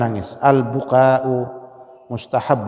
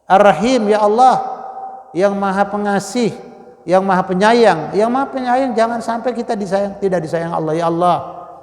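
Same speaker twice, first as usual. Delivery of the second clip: louder, higher fervent prayer; fervent preacher's invocation; impassioned plea; 6 semitones higher, 4 dB louder than usual. Real, not acted. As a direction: cry out to the Most Merciful. Impassioned prayer invoking Allah.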